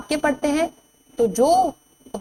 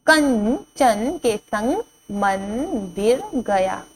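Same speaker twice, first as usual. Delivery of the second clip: slow reading; much slower than usual. Shown as a demonstration. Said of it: "slow sentence reading".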